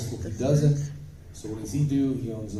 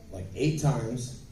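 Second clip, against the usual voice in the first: ordinary delivery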